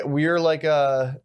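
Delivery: ordinary talk, nothing marked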